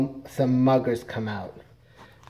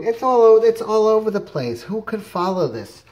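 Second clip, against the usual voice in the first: exasperated; 9 semitones higher, 6 dB louder than usual. Real, not acted. Complaining about the comic.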